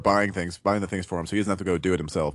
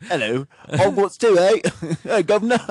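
high, loud hammed-up British accent; jokey over-the-top British "guv'nor" voice; mock British accent; 12 semitones higher, 6 dB louder than usual. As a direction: ham up a silly British accent, pitched way up. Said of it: "You gotta do the accent".

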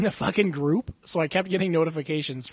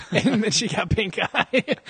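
laughing; 6 semitones higher, slower than usual. Real, not acted. Laughing at own gross story.

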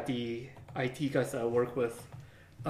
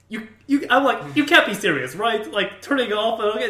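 incredulous; 7 semitones higher, 6 dB louder than usual. Retold or imitated. Mocking coworker's fax suggestion.

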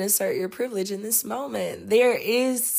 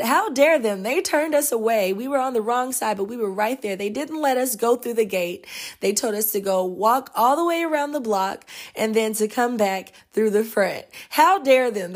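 imitating angry tourists; 3 semitones higher, 4 dB louder than usual. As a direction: ham up the indignant complaining. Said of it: "And they were just so angry".

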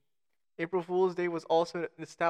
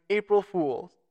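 raised, higher quoted exclamation; mild mock shout; 4 semitones higher, 6 dB louder, slower than usual. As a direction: call it out like a prankster, half-hearted. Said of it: "expose their actions by shouting".

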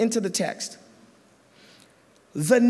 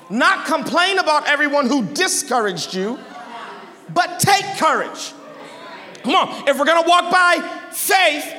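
high, punchy sermon exhortation; full-force preaching; 7 semitones higher than usual. Acted. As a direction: drive it home to the congregation.

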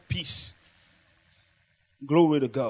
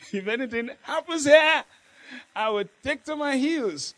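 mock fear; 8 semitones higher, 5 dB louder than usual. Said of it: "imitating a scared person".

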